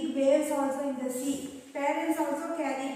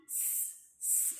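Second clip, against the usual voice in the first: drawn-out hiss through the teeth like a snake; 18 dB quieter than usual. Shown as a demonstration. Teacher showing the children a snake's sound, hissing. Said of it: "like that, right?".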